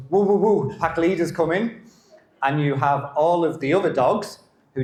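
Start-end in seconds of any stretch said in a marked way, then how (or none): 0.1-1.8 high, loud yipping alarm; excited dog voice. Retold or imitated. German Shepherd warning the kennel, high and loud. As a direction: announce the boss like an excited dog. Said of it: "German Shepherd who stands up and goes".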